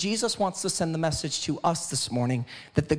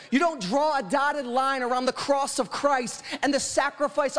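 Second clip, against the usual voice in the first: higher, rhythmic litany, each title proclaimed; full-force preaching; 7 semitones higher than usual. Real, not acted. Preacher answering "who is this Jesus" for the church, full-force preaching.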